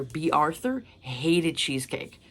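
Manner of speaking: normal talking voice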